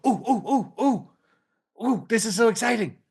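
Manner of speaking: high, delighted cooing ooh-ooh; hammed-up thrill at the breakthrough, pitched high; mock excitement. Shown as a demonstration. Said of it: "And then you go".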